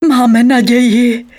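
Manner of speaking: high, drawn-out happy call; joyful calling. A narrator's reading. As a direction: call out good news joyfully. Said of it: "radostně volal". (radostné, protáhlé volání)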